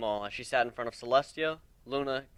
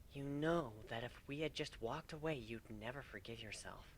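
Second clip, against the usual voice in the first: gentle; 14 dB quieter than usual. Acted. Kuno gently nudges husband.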